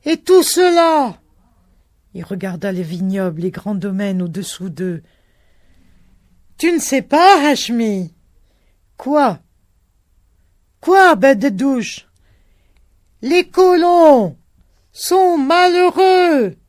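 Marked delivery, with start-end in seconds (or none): 0.1-1.2 high, loud cry; shouting. A narrator's reading. Continued shout. 6.6-16.6 high, loud, slowing shout; two fellahs shouting against the wind; shouting over wind. A narrator's reading.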